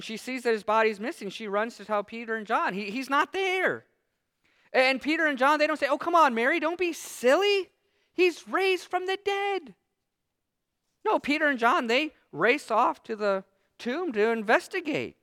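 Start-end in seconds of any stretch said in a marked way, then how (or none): 5.9-9.7 much higher, sing-song dismissive disciples; mock scoffing. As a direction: play the brushed-off scoffing friend. Imagined disciples scoffing at Mary.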